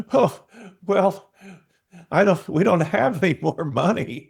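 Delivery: flustered. Retold or imitated. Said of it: "I'm like".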